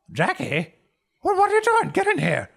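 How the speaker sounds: sudden very high, loud alarm; shocked call. Acted. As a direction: cry out at the beaten boy. Innkeeper shocked at beaten kid.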